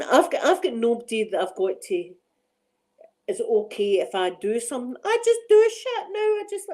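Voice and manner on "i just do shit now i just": squealing high with glee, half laughing; giddy excitement. Real, not acted. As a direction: confess the wild streak in a high giddy squeal. Giddy confession of wildness.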